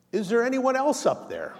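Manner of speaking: high, loud call for someone else; desperate hiker call. Acted. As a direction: ask it hoping anyone else answers. Punchline, hiker asks again.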